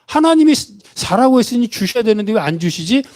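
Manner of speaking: high-pitched, retold puzzlement; puzzled wondering. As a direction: wonder aloud, voice lifted high. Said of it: "이상하다라고 궁금했대요". (높은 목소리로 의아해하듯)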